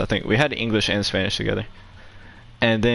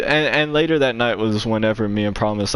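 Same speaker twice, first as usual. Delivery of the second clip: ordinary talk, nothing marked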